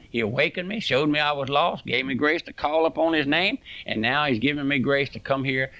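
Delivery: ordinary delivery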